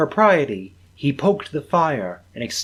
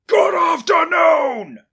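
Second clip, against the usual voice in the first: high, loud, slow bellow; furious shout; 8 dB louder, slower than usual. Acted. Scrooge driving his nephew out, shouting. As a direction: bellow him out the door.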